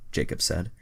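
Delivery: ordinary delivery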